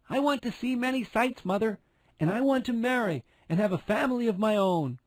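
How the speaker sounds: put-on a tiny girl longing for the world; young Thumbelina. A narrator's reading. Thumbelina asks her mother.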